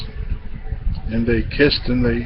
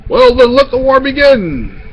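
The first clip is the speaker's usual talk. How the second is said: high, forceful storybook battle cry; war declaration; 11 semitones higher, 4 dB louder than usual. A narrator's reading. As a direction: proclaim the start of war. Gorilla declares war on the queen.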